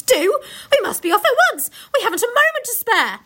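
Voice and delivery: high loud hurried command; rally them at once; urgent Beaver. Acted.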